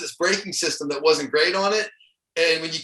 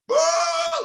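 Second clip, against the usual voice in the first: sharp gasp that jumps much higher; imitating a scared student pilot's gasp; panicked gasp. Pilot recalls flight school panic, sharp high gasp. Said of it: "like this".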